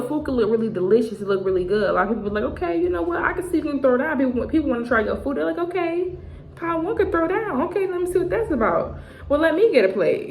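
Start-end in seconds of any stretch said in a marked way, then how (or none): 2.5-4.2 impressed onlooker. Retold or imitated. People admiring the cook. 5.6-10.3 eager onlooker. Retold or imitated. People wanting to try food.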